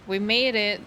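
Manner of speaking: normal talking voice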